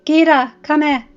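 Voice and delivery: loud, high shout; urgent call. A narrator's reading. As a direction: summon her from a distance. Calling someone over.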